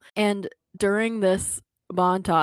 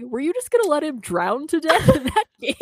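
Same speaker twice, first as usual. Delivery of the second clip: incredulous; 9 semitones higher, 6 dB louder than usual. Retold or imitated. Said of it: "And I was like".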